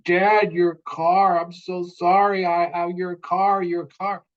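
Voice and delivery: high, slow, pleading son in tears; imitating crying son. Son in the ER apologizing to dad, high and slow, imitating tears. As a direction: plead like a sorry teenager. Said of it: "he's crying and saying".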